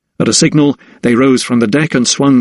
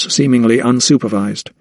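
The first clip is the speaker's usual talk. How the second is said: ordinary delivery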